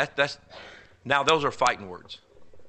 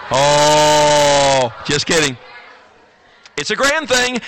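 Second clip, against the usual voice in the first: loud laughing take-back; playful loud backpedal; joking; 4 semitones higher, 10 dB louder, much slower than usual. Real, not acted. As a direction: laugh it off loudly.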